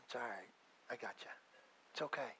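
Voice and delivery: soft, low, tender reassurance; God reassuring gently. Pretend. Preacher voicing God comforting a weary sinner, soft and tender. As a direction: hush them like a comforting father. God's reassuring reply voiced.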